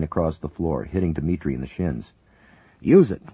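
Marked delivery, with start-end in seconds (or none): 2.8-3.3 very loud, higher yell. A narrator's reading. Paramedic yelling at the agent to use the rifle. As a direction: shove the order at him. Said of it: "Lincoln yelled at the agent".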